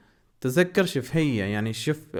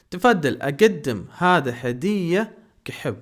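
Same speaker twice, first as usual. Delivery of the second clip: تفضل، اقدم هذا هدیہ said slowly and a little higher; slow model sentence; 4 semitones higher, 4 dB louder, slower than usual. Shown as a demonstration. Teacher reciting the full gift sentence slowly for learners. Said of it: "یعنی میں یہ محبت کے طور پہ".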